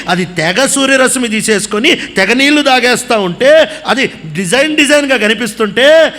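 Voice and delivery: ordinary talk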